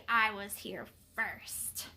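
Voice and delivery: slow, low growl, softly; growling dog. A narrator's reading. Dog stakes his claim against a rival, low growl. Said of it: "growls grew louder and deeper".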